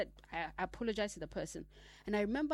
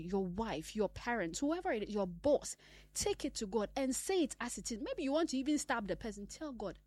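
usual speaking voice

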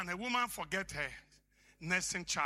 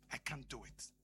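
1 defeated murmur; 7 semitones lower, 11 dB quieter than usual. Acted. Voicing the defeated listener.

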